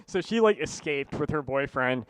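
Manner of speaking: ordinary delivery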